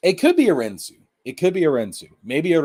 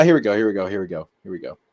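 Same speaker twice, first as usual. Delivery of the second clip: usual speaking voice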